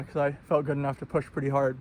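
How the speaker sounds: ordinary talk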